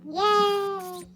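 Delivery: squeaky helium voice. Pretend. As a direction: talk as if you inhaled helium.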